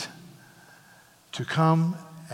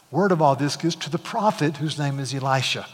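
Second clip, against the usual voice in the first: normal talking voice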